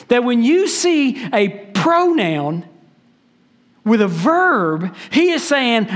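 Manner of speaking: ordinary talk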